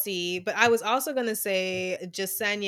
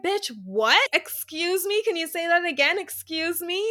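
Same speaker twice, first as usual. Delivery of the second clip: higher, incredulous excuse me rising; mock outrage at their hypocrisy; indignant disbelief; 7 semitones higher than usual. Reacting to Piper and Brendan, higher indignant disbelief. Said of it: "And it was like".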